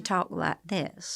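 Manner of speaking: put-on soft, slow Southern accent; Southern drawl. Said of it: "the hostess who was Southern".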